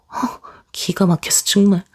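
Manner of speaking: disbelieving whispered scoff. Acted. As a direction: breathe it out in stunned disbelief. 동서가 어이없어하는 장면. (기가 막혀 속삭이듯 내뱉는 말)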